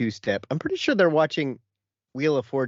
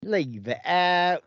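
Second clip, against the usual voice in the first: put-on Essex accent; 5 semitones higher, much slower than usual.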